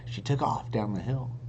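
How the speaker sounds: usual speaking voice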